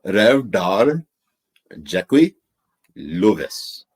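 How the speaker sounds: put-on slow, loud Pakistani accent. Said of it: "Let [name] read them in the Pakistani accent".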